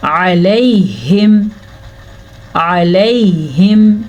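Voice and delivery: अलैहिम slow with a long vowel; stretched ain. Shown as a demonstration. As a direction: chant अलैहिम slowly. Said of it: "मेरे साथ साथ आइन की practice करें".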